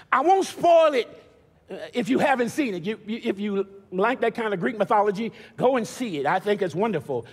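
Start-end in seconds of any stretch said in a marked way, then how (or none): none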